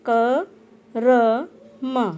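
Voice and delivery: क, र, म each said apart with small pauses; syllable by syllable. Shown as a demonstration. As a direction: sound out क, र, म one by one. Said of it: "इसे हम बोलेंगे".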